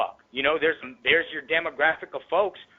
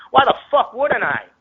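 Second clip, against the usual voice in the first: higher and louder, fired back at critics; indignant outburst; 12 semitones higher, 7 dB louder than usual. Real, not acted. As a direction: throw the question back hard. Angry rebuttal to critics.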